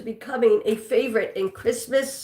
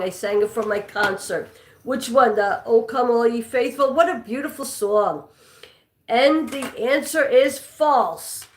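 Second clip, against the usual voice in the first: normal talking voice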